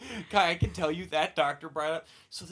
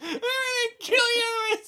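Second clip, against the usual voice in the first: high, loud tearful yell; shrieking tearful threat at a classmate; crying kid shout; 7 dB louder than usual. Retold or imitated. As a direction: scream it like a bawling seven-year-old. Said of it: "I'm like".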